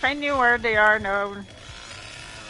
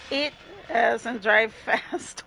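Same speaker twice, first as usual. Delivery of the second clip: usual speaking voice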